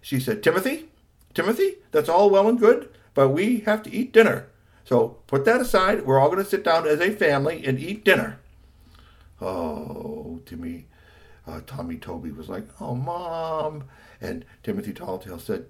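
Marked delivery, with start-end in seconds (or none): 0.4-8.4 high, loud, insistent interruption; practical wife. A narrator's reading. Wife tells husband dinner comes first, insistent. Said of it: "she was the practical one". 12.8-13.8 whiny complaint. Acted. Son complains to mom, whiny.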